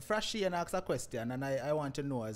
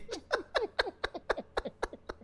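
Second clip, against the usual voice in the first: real laugh. Real, not acted. Host laughs at the impersonation.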